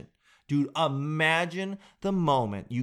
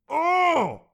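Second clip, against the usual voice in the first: loud, high offended gasp-cry; exaggerated shocked Oh; mock outrage; 6 dB louder than usual. Real, not acted. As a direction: recoil as if insulted. Outraged exclamation.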